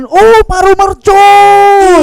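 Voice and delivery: slow, loud, high hype call; excited shout. Acted. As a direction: shout the dish name like a host. Announcing spicy snack name. (teriakan bersemangat)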